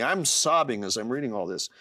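usual speaking voice

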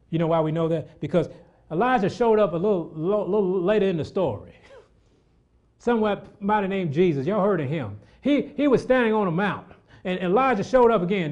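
loud, high preaching; fired-up preacher voice; preaching at full force. Acted. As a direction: ride the crescendo.

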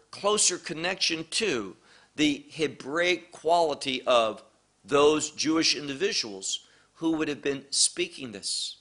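normal talking voice

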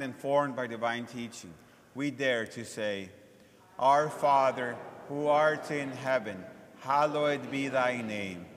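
ordinary talk, nothing marked

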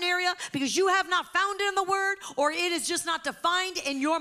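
high, loud, driving sermon delivery; full-force preaching. Acted. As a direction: proclaim it forcefully to the whole congregation.

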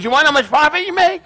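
high, fast challenging question; mock incredulity. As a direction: play a father pouncing on his son's answer. Joke father challenges his son.

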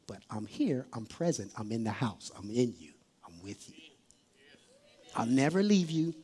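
very low, near-whispered comfort; tender, intimate hush; hushed divine reassurance. Acted. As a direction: soothe them softly, as Jesus calming a worrier. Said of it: "So he's saying".

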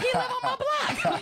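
amazed. Retold or imitated. Host retells his own amazed reaction.

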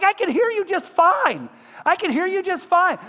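loud, very high imitation of an amazed woman; imitated joyful squeal; excited exclamation. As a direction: cry it out high, thrilled at hearing. Healed friend's excited cry, retold.

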